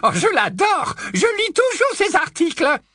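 put-on high, loud old busybody voice; high loud tipsy old aunt gushing; old aunt Muriel. Narrator voicing aunt Muriel.

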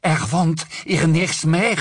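a scared woman crying out; panicked cry in a foreign tongue; frightened cry. A narrator's reading. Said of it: "s'écria-t-elle".

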